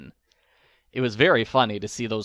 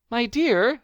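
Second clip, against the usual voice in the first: much higher and louder; dismayed cry; 11 semitones higher, 4 dB louder than usual. A narrator's reading. Old woman seeing the cats arrive, dismayed cry. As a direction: alarm at the sight. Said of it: "she cried".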